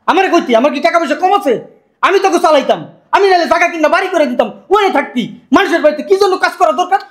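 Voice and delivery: high-pitched, reproachful rant; hurt, worked-up reproach; indignant scolding. Acted. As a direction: scold them with rising outrage. Indignant scolding of working relative.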